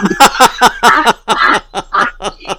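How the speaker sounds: real laugh at the punchline; hearty amused laughing; laughter. Real, not acted. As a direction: break up laughing.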